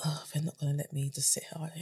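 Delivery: whispered, to herself; hushed aside, resigned to being called up. Retold or imitated. Newcomer realising she can't hide, whispered dread. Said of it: "I was like".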